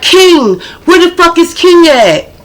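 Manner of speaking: high mock yell calling him out; retold yelling. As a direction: holler for him like a furious girlfriend. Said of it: "he must have heard her yelling".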